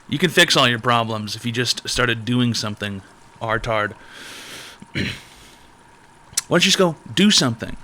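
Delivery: usual speaking voice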